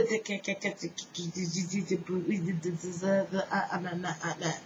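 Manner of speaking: stuttered the, breaking into a laugh; imitated stutter. Describing the stutter heard in his head, stuttering with a laugh. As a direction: stumble on the syllable, laughing. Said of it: "I could hear the stutter in my head".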